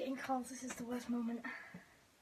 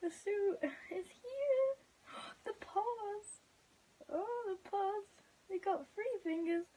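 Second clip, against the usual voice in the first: high, teary and delighted; much higher, crying happy squeals; overjoyed; 7 semitones higher than usual. Real, not acted. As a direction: gush with tearful joy. Overjoyed, suit arrives, crying.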